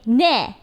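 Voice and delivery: "ne" said short with a falling tone; short clipped "ne"; accented short form. Retold or imitated. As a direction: clip it down to "ne". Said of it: "very strong, strong accent".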